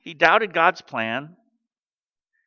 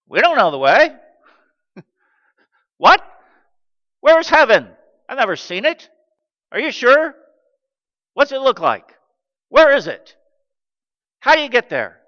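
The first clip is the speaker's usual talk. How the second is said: Thomas asking; 7 semitones higher, 7 dB louder, faster than usual. Acted. Preacher voices doubting Thomas.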